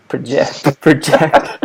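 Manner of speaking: rising, punchy catchphrase; catchphrase delivery. Shown as a demonstration. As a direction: sell it like a catchphrase. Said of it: "I need a catchphrase".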